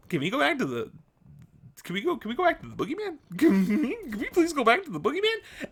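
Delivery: high, fast, laughing plea to go back to the boogeyman; pleading. Retold or imitated. To her listening group, begging them to return to the boogeyman, high and frantic. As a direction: beg frantically through laughter.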